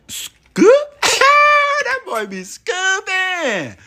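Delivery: high, loud laughing yell, then slow; screaming hype. Acted. Hyping his own scooping.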